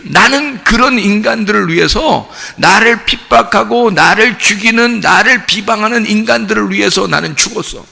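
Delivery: normal talking voice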